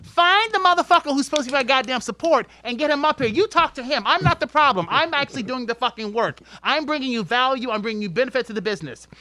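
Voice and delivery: loud, high, heated telling-off; retold tirade. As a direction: lay into the managers, preaching loud.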